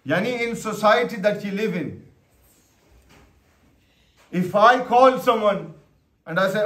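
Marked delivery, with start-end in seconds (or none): none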